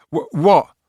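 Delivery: high startled yelp, jolted awake; a boy jerked awake stammers in surprise. Acted. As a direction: blurt it out half-asleep and alarmed.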